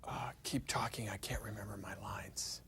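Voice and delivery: stage whisper. Acted. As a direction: whisper it under the dialogue. Voices actor whispering for help.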